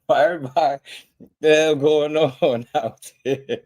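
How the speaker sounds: amused high-pitched ribbing; laughing tease. Real, not acted. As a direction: laugh through the question at the mad fans. Laughing teasing of angry chat.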